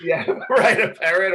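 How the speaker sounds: laughing. Real, not acted. Laughing about odd requests.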